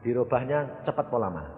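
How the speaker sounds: ordinary delivery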